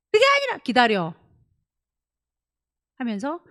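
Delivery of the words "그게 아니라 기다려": firm insistence. Retold or imitated. Child interrupting, mother insists.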